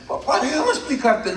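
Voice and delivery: put-on high, pleading little-boy voice; pleading child. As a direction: beg dad to listen. Son begs to explain. (niño suplicando con voz aguda)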